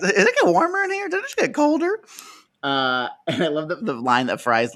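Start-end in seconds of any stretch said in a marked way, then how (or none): 0.0-2.0 high, fast mock mermaid; squeaky innocent question.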